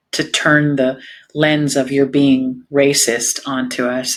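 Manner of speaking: ordinary talk, nothing marked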